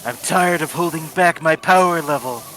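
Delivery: lightly voiced edgy anime rival; Shadow voice. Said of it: "That was my Shadow voice".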